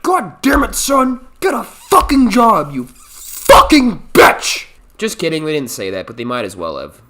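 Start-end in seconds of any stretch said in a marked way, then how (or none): 0.0-4.7 high, loud, furious parent yell; mock angry yelling. Parents yelling at their jobless son, mock furious yell. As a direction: scream at the lazy son. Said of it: "they were like".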